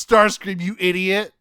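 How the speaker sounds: loud high scolding yell; angry Megatron. Retold or imitated. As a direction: chew him out. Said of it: "Megatron goes".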